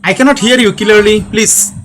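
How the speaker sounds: usual speaking voice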